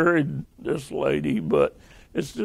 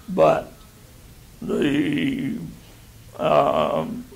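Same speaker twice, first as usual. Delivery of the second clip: ordinary delivery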